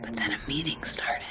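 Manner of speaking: hushed whisper during a call. Real, not acted. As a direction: whisper so the meeting won't hear. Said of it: "I don't know why I'm whispering".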